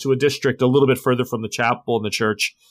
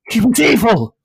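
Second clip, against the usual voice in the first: high, loud frantic cry; a beaten man shouting his excuse; desperate shout; 11 semitones higher, 8 dB louder than usual. Acted. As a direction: blurt it out in terror. Maurice cries out in terror.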